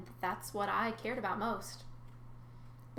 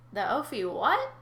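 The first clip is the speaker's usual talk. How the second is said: baffled; 2 semitones higher, 5 dB louder, slower than usual. Acted. Baffled by strange name.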